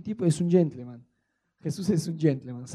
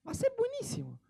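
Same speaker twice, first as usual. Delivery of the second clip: squeaky mock reply; 9 dB quieter than usual. Mock answer from a couple.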